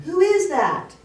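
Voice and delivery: high, loud mimicked echo; acted voice calling back. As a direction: echo the boy's call from afar. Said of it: "In the background".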